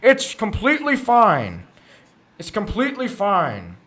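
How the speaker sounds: high, loud mock-cheery outburst, easing off; over-the-top high-pitched insistence, winding down; mock reassurance. Fan mocking excuses for the coach's interviews, mock reassurance.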